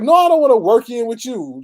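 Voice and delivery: loud, high, exasperated refusal; indignant retort. Retold or imitated. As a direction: re-enact snapping back at a pushy stranger. Acting out refusal to gym guy.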